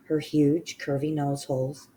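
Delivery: usual speaking voice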